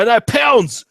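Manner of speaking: a loud, high boast of knowing pounds; emphatic. Real, not acted. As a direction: belt it out triumphantly. Listing units he knows.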